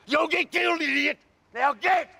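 high, shouted threat; raging, voice pitched right up; furious threat. Real, not acted. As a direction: yell a real warning at the reporter. Threatening the reporter.

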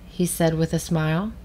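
ordinary delivery, nothing marked

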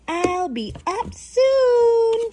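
high, slow, drawn-out call; mama calling back. Acted. Mama llama answers her baby, calling back. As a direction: sing it back up the stairs. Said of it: "Mama says".

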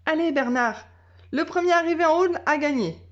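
high, quick playful call; challenging cry. A narrator's reading. Girl challenging her dog to a race, quick and high. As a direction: race me to the top. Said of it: "cria-t-elle".

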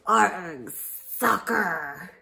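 loud pirate cry; pirate yell. Acted. Captain kicking the ball, loud pirate yell. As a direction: yell it as you kick. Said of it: "kicked the ball and yelled".

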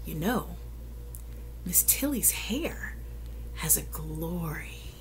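hushed aside. Real, not acted. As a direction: lean in and whisper it with wonder. Reader's soft aside to listeners.

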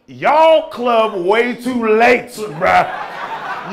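mock-indignant slow rant; drawn-out disbelief. Real, not acted. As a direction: drag out the outrage. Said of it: "slow emphatic complaint".

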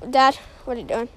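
ordinary delivery

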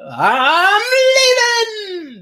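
high, loud, hugely stretched devil wail; very slow, shouted devil voice. Retold or imitated. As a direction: drag it out like a defeated demon. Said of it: "my best devil voice".

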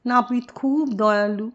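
ordinary delivery

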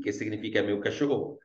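normal talking voice

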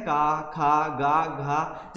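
का, खा, गा, घा sounded slowly, each with a clear long आ; syllables demonstrated. Shown as a demonstration. Teacher showing students the आ sound, slow syllables. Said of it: "हम क्या करते हैं".

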